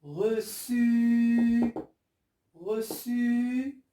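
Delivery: "reçu" with the final U held very long; drawn-out model reading. Shown as a demonstration. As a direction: sound out "reçu", clear S and long ending. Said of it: "je vais lire".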